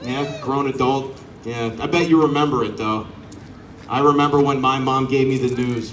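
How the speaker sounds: ordinary delivery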